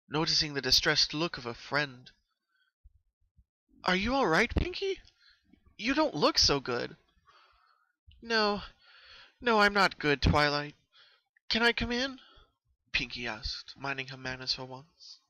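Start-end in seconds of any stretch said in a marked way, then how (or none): 3.8-7.0 high, louder worried question; concerned Twilight. Acted. Twilight noticing Pinkie looks unwell, high worried voice. 8.2-12.2 high, louder pleading voice; distressed Pinkie. Acted. Pinkie asking Twilight to let her in, high distressed plea.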